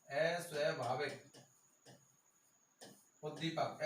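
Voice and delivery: अस्वाभाविक उद्दीपक drawn out slowly, word by word, with a pause between; slow dictation. Shown as a demonstration. Teacher dictates the term slowly for students to write.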